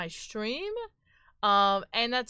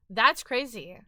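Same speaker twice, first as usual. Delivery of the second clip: usual speaking voice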